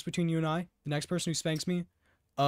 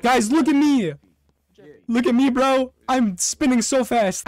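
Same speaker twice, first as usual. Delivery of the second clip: excited shouting; 5 semitones higher, 7 dB louder than usual. Real, not acted. Excited shouting about spinning.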